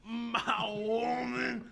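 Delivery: very slow, drawn-out mimicry; mocking imitation. Teasing a friend's reading, drawn-out imitation. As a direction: drag out "woman" in a put-on voice.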